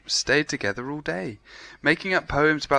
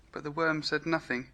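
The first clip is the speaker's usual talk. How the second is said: ordinary delivery, nothing marked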